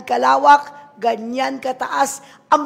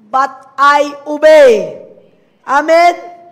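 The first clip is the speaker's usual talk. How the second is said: preaching shout; 3 semitones higher, 12 dB louder, much slower than usual. Real, not acted. Climactic declaration to congregation.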